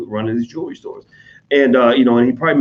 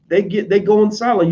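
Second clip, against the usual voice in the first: ordinary delivery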